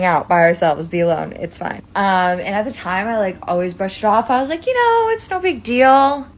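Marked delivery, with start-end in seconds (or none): none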